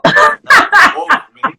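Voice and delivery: high, loud outburst; sudden loud correction. Real, not acted. Telemarketer correcting herself mid-story, a sudden loud outburst. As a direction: cut yourself off and shout the fix.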